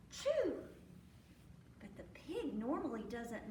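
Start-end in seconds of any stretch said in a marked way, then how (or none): 0.1-0.5 gasp, then high "choo"; mimed sneeze. A narrator's reading. Storyteller as the pig catching cold, mimed high sneeze. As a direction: act out the pig's sneeze. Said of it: "and the sneeze".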